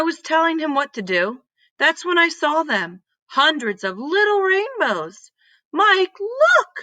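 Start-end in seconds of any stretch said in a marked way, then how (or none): 5.7-6.8 high, loud call to a friend; excited yell. Acted. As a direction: shout it out, pointing at the rainbows. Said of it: "I yelled".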